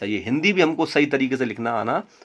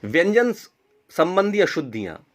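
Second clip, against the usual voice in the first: ordinary delivery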